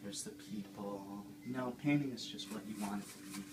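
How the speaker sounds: gentle, soothing painting-show host voice, a Bob Ross send-up; Bob Ross Junior. Acted. Host of a painting show, talking to viewers at home: soothing Bob Ross voice. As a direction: coax the canvas along like a dreamy TV painter.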